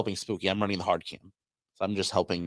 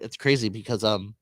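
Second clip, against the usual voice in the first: ordinary talk, nothing marked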